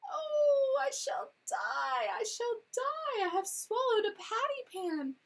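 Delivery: high, loud wailing lament; howling. A narrator's reading. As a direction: howl it out, dragging the words slowly. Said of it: "Duchess set up a howl".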